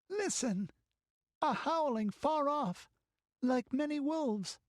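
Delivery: put-on high, hushed girl's alarm; frightened girl. Girl warns Balessa of howling, frightened. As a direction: warn of distant wolves.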